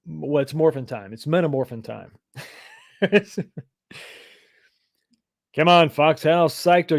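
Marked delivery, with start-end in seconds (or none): 3.0-3.6 high burst of laughter; laughing. Real, not acted. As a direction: laugh at your own joke. Laughs at own pun.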